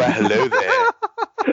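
drawn-out high announcer hello, laughing; mock announcer greeting. Joking friend tests a radio announcer voice on the intro. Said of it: "radio announcer voice".

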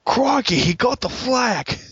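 high, excited Australian wildlife-host voice; Steve Irwin. Acted. Alternate game announcer calling a capture, high mock-Australian cry. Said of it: "I'm going with the Steve Irwin".

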